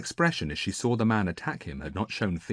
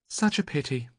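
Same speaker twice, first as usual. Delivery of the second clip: dryly; 7 semitones higher than usual. A narrator's reading. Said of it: "said Andromache dryly".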